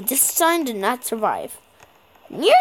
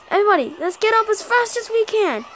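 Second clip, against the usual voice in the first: ordinary talk, nothing marked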